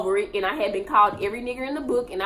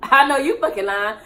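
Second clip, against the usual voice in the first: loud outburst; 2 semitones higher, 6 dB louder than usual. Real, not acted. Outburst at the family.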